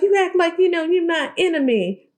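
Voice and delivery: high put-on voice, imitating; squeaky mock complaint; mock accusation. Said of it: "She's like".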